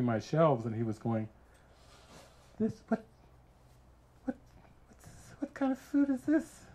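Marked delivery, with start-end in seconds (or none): 2.6-6.6 high, halting incredulous questions; baffled disbelief. Retold or imitated. Friend looking through food shelves, high-pitched bafflement. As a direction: squeak it out, stammering in mock bewilderment.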